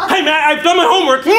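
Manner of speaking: high, loud, laughing fake cheer; loud bright mock chirp; fake cheerful student. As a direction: pretend everything is fine. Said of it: "you come into class going".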